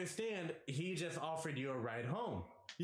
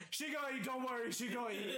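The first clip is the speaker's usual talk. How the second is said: high mock reassurance; 10 semitones higher, faster than usual. Friend mocked reassuring about girl.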